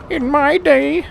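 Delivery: put-on high, loud cranky old-timer; crotchety old man voice. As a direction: grumble it like a grumpy grandpa. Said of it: "like, a crotchety old man voice".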